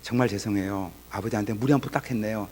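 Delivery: soft, subdued imitated apology; humble apology. Son kneeling to apologise to his father, humbly contrite. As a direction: apologise low and meekly. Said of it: "무릎 꿇고 인사 한번 하고".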